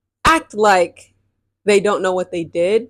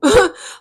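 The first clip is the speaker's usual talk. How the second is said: scornful laugh; 13 semitones higher, 6 dB louder than usual. Real, not acted. Bitter laugh at commenter.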